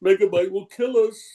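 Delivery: high-pitched cartoon cowardice; comical cartoon character. ReBoot character fearing the villain, comically frightened. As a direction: whimper for protection in a squeaky put-on voice. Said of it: "also on Reboot, but more comical".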